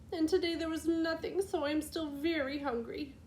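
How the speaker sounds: put-on a hurt little girl pouring out her woes; tearful girl. A narrator's reading. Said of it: "Little Two Eyes sobbed".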